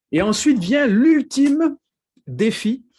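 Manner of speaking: high, loud, drawn-out dramatic build-up; mock-grand announcement. Preacher jokingly hyping the last step of his exercise, mock-grand. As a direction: announce it like an epic showdown, tongue in cheek.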